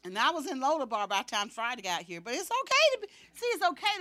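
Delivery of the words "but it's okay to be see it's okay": much higher, louder exclamation; sudden high preacher's cry; preaching lift. Acted. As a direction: lift the congregation up. Preaching emphasis.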